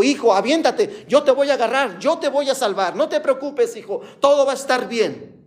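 high, insistent calling to a son; imitated father's shout. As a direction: raise the pitch as if calling up to someone. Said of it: "el padre le gritaba".

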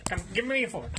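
ordinary talk, nothing marked

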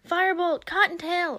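loud, high-pitched holler; screamed call. A narrator's reading. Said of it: "a voice screamed".

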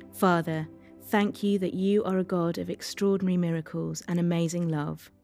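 ordinary talk, nothing marked

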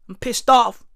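ordinary talk

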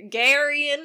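"Garion" in a drawn-out Texas accent; "Garion" said with a Texas twang. Shown as a demonstration. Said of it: "My Texas accent showing through there".

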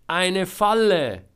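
very loud, high, drawn-out exclamation; desperate shout. A narrator's reading. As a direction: shout it slowly across a distance. Trapped Georg shouts explanation. (laut und gedehnt ausrufen)

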